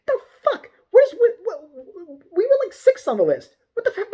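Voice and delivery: high-pitched, incredulous squawk; exasperated outburst. Real, not acted. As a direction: sputter in loud, high disbelief. Exasperated outburst at closure rules.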